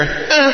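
put-on tiny high-pitched baby bleat; short, high mimicked cry; baby's cry. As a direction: imitate a faint infant squeak. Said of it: "She would hear".

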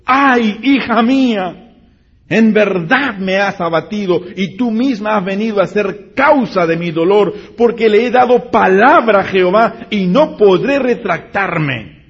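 loud, high grieving cry of a father; anguished lament. Acted. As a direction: wail it out like a father tearing his clothes. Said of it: "rompió sus vestidos diciendo". (lamento angustiado de padre)

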